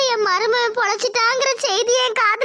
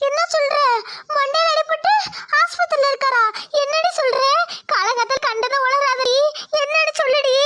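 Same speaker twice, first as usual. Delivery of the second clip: alarmed disbelief; 3 semitones higher than usual. Acted. Mother hears son-in-law hurt.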